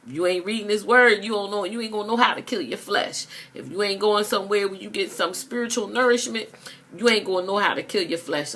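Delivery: high, fast urgent preaching. Acted. Preacher warning listeners who skip the word, urgent. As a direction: press the warning home.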